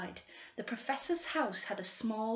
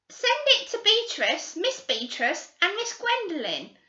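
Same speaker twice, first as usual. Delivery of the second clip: greedy schoolgirl; 10 semitones higher, 8 dB louder than usual. Acted. Girl bargaining with the detectives, high and wheedling. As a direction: bargain sweetly for the reward. Girls voiced by narrator.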